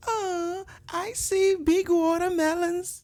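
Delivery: high, slow chirpy assistant. Pretend. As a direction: chirp like a perky AI. Mimicking the AI voice.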